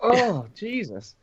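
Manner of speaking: mock horrified. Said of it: "it's spine tingling".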